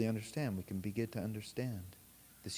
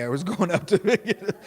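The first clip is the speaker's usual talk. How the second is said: high, loud laughing confession; 9 semitones higher, 12 dB louder than usual. Real, not acted. Telling the Coney Island mistake, laughing high and loud. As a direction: laugh through the words at your own blunder.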